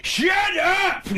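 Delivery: high, shouted Sandler impression; Sandler yell. Comic imitating Sandler's yelling third phase, a shout. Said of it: "he yells".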